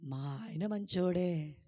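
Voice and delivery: very slow, careful model line; slow, patient teaching voice; slow demo answer. Shown as a demonstration. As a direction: say it slowly so they repeat it. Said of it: "ఏమనాలి?".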